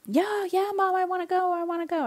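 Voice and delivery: lightly voiced excited children; eager kids. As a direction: imitate the kids pleading, Mom I wanna go. Kids eager for Tim Hortons.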